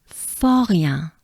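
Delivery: "Forien" with French R and nasal ending; French pronunciation demo. Shown as a demonstration. Teacher working on part of a name, French pronunciation. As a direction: model "Forien" with a breathed French R. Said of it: "we're gonna work on this part".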